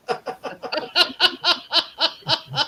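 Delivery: long, high-pitched giggle; real laugh. Real, not acted. Teasing about Gail's hamster face, high giggling laugh. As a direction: crack up at your own joke.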